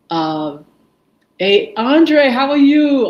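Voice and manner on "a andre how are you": excited greeting. Real, not acted. Host greeting a friend who just joined the stream, loud and excited. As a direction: lights up and hollers hello.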